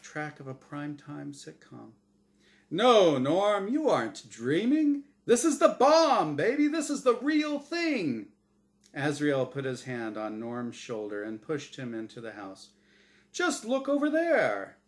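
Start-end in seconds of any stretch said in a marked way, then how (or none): 2.7-8.3 much higher, loud showman energy; hyped game show host. Acted. Host insisting this is real, wild showman hype. 13.3-14.8 much higher, loud showman energy; hyped game show host. Acted. Host insisting this is real, wild showman hype.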